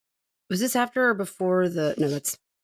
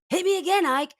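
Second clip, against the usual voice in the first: mock falsetto quote; 12 semitones higher than usual.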